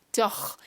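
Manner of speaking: "Doch" ending in a long throaty ch; rasping ch sound. Shown as a demonstration. Teacher showing the nasty sound, rasping ch. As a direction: scrape the end of "Doch" like a choke. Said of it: "it's a ch sound".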